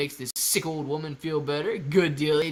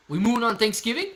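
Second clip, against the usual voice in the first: higher and louder, rising on Thanksgiving; amazed question; 7 semitones higher, 5 dB louder than usual. Real, not acted. Streamer echoing a viewer's Thanksgiving moon hopes, amazed and rising. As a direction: toss it back like you can't believe it.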